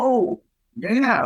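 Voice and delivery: high, impressed exclamation; mock awe from tough guys; impressed whoa. Rough guys impressed by assault.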